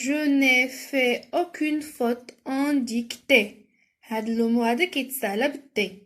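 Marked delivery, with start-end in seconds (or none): 0.1-3.5 very slow, carefully separated words; measured classroom dictation, drawn out; slow dictation reading. Shown as a demonstration. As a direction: read it out slowly for pupils writing. Reads exercise sentence for spelling.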